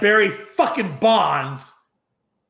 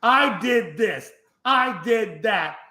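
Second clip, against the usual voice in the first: higher, slow, sing-song admission; slow, higher mock Pete Rose; slow mock confession; 5 semitones higher, slower than usual. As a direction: drag it out like a rehearsed apology. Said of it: "and states".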